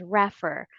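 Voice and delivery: "refer" as RAF-er, stressed up front; wrong first-syllable stress. Shown as a demonstration. Teacher recalling learners' old habit, "refer" misstressed as RAF-er. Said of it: "you find it awkward if you have been pronouncing".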